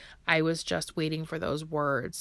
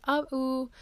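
high, unclear attempt at love you; toddler's approximation; 8 semitones higher than usual. Mom imitating her son's first try at the words, high. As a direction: mimic a child straining to say it. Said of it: "more kinda like".

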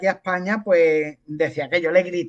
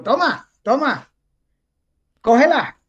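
higher, insistent handing-over call; urgiendo a cogerla; 4 semitones higher, 4 dB louder than usual. Retold or imitated. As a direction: urge someone across the table to grab it. Pasa una botella pesada, urgiendo. (insistente, apremiando con voz más aguda)

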